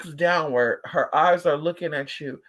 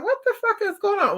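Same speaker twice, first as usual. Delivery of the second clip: much higher, startled cry of disbelief; shocked disbelief. Retold or imitated. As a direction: squeal it like you can't believe your eyes. Retold shock at scared dog.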